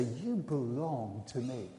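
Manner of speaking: very slow, low and soft reassurance; hushed, deep, unhurried tenderness; tender welcome. Acted. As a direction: welcome them gently, stretching each word. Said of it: "he wants to welcome you".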